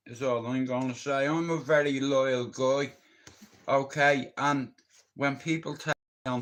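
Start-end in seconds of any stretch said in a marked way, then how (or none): none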